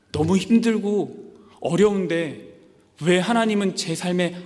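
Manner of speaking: a hurting believer calling out to God; anguished plea. Retold or imitated. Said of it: "이런 질문 하신 적이 있으신가요".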